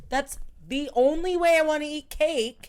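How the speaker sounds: put-on loud, high kid's outburst; a child's passionate protest; indignant child. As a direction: whine it loudly like a child. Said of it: "especially when you're a child, you're like".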